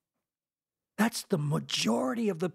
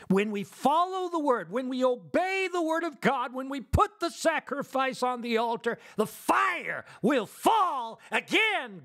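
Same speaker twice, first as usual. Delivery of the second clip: fervent preaching climax; 8 semitones higher than usual. Real, not acted. Preacher promising the congregation the fire will fall, fervent. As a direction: build each clause higher, stretching the last words.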